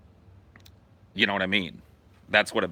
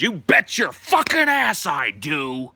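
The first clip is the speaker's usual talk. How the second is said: slow, louder, defiant growl; angry retort; 6 semitones higher, 5 dB louder, slower than usual. Retold or imitated. Joe cuts off the union chair, slow defiant retort. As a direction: spit it back at the chairman.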